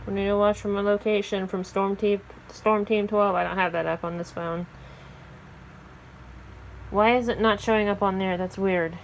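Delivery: ordinary talk, nothing marked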